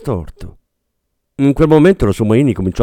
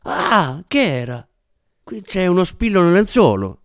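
startled, higher-pitched little yelp; sussulto di sorpresa; 7 semitones higher than usual. A narrator's reading. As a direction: react as if suddenly pricked. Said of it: "L'attrice sobbalzò". (trasalendo, voce acuta)

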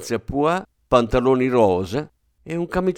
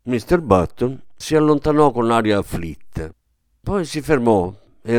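ordinary delivery, nothing marked

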